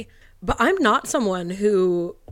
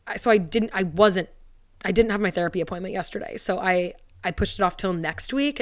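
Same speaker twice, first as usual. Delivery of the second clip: usual speaking voice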